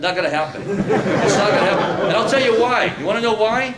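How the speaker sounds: loud, hard threat from an acted prison bully; menacing inmate threat. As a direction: lean in and threaten him like a cell-block predator.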